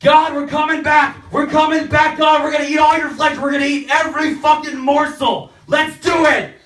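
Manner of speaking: high, loud excited shouting; eager shout. Retold or imitated. Catholics yelling back to God, high excited shouting. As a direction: roar your eager return.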